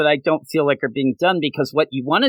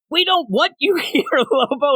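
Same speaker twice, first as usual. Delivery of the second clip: higher, mocking brush-off; mock rejection; 12 semitones higher than usual. The team telling Lobo to leave, high mock brush-off. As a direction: shoo him away in a high voice.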